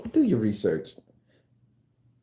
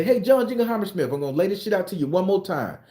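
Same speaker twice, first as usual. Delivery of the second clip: high calling out; 9 semitones higher than usual. Acted. Italian man calls out founder.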